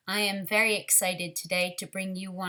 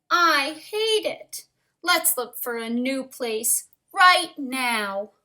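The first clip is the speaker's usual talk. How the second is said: cross; 5 semitones higher, 8 dB louder, slower than usual. Acted. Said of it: "said Missus Bird".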